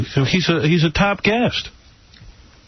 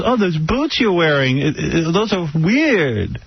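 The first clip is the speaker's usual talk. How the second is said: put-on slow, higher Romanian-accented put-down; mocking Romanian accent; 3 semitones higher, slower than usual. Woman judging his boots, mock Romanian accent. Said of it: "I hate that accent anyway".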